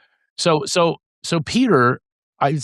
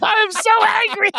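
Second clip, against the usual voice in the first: very high squeal of mock anger; squeaky, laughing fake fury; anger laughed out. Shown as a demonstration. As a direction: shriek it through helpless giggles. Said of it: "say it out loud".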